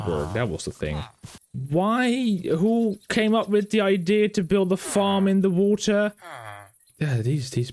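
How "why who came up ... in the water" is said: baffled outburst. Real, not acted. Baffled at water farm.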